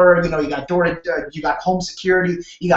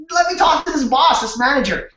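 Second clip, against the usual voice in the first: excited outburst; 6 semitones higher than usual. Retold or imitated. Eager retort to a friend.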